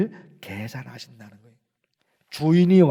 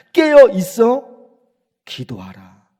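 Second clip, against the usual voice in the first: Jesus urging; 3 semitones higher, 8 dB louder, slower than usual. Acted. Preacher voicing Jesus telling the disciples, loud then hushed. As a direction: cry out the warning, then drop to a hush. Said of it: "예수님이 제자들에게 얘기하신 거예요".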